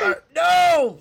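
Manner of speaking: high, loud shout of disbelief; shocked no. Real, not acted. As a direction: yelp out no in mock horror. Realizing the name was wrong.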